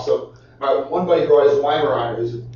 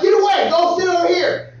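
scolding; 12 semitones higher than usual. Retold or imitated. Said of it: "I would scold him".

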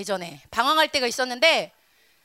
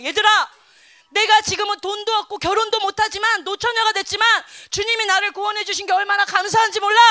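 louder, higher, fervent retold sermon; a touch of excited preacher; preaching fervor; 6 semitones higher, 6 dB louder than usual. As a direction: preach it with joyful zeal to kids. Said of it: "이런 얘기를 한 거야".

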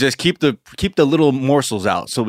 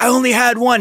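put-on whiny kid; 13 semitones higher, 4 dB louder, faster than usual. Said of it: "He's like".

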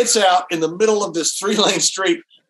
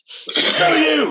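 higher, a touch angry; retold angry retort; 8 semitones higher than usual. Tom snapping at Godfather who mocked Preludes, mock angry. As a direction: snap back in mock fury. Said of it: "now Tom's really mad".

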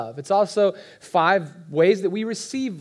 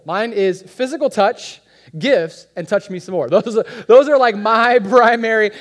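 laughing, much louder; 3 semitones higher, 8 dB louder than usual. Real, not acted. Pastor jokes about his own love language, laughing loudly. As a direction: crack up at the joke.

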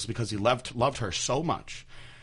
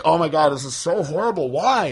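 raised, loud appalled cry; 6 semitones higher, 6 dB louder than usual. Retold or imitated. Son appalled at mom's story, raised voice. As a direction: cry out in horror. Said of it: "And I was like".

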